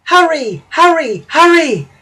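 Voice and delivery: high, loud, urgent cries; urgent panting engine. A narrator's reading. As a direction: drive the trucks on frantically. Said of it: "he panted to the trucks".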